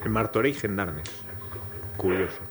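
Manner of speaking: quiet and dry; low, flat mutter of disbelief; deadpan murmur. Real, not acted. Host deadpan, quiet echo.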